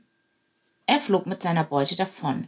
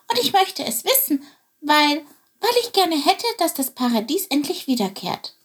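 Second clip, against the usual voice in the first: eager sparrow voice; 8 semitones higher than usual. Acted. Chilp stammers his reason.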